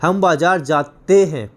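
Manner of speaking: slow, जाते split as जा-ते हैं; जाते drawn out for teaching; plural ending stressed. Shown as a demonstration. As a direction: stretch जाते to point out ते. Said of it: "plural हो गया".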